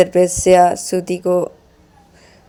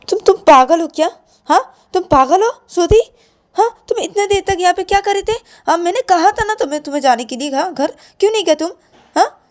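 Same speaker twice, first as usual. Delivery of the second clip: much higher, fast, angry scolding; 12 semitones higher than usual. Acted. Shreya angrily scolds Shruti inside the car, high and fast. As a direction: tear into her for waiting so long. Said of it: "इतना गुस्सा क्यों कर रही हो?".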